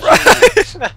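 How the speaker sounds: calling out. Real, not acted. Calling friend in panic.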